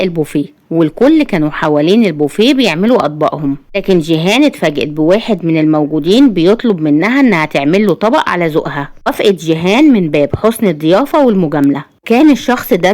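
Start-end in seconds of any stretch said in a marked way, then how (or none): none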